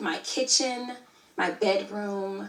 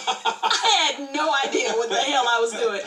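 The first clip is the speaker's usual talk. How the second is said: higher, laughing at herself; self-mocking, amused outburst; laughing confession; 5 semitones higher than usual. Real, not acted. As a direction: admit it through laughter. Laughing at own failed venture.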